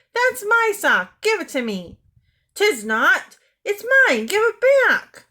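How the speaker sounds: high, loud squabbling shouts; two kids fighting over a sock; children quarrelling. A narrator's reading. Twins fight over a sock, high angry shouts.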